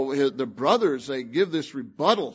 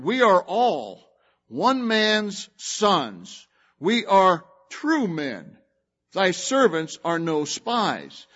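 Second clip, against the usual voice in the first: slow, measured reading of the verse; scripture reading; 3 semitones higher, slower than usual. Acted. Preacher reading the brothers' plea, a slow solemn recital. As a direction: lay each word down solemnly.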